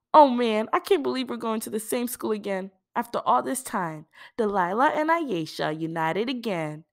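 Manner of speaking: high, excited reunion cheer; Aisha, delighted. Acted. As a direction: celebrate being together again.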